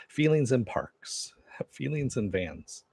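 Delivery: breathy joking whisper, slowed; whispered gag with stifled laughs. Pretend. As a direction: whisper the silly titles like a secret. Joking list of silly titles.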